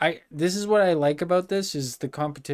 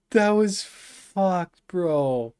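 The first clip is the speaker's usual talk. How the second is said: slow, higher, still laughing; laughing drawl; 3 semitones higher, much slower than usual. Real, not acted. Streamer recovering from laughter, slow and amused. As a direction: stretch it out mid-laugh.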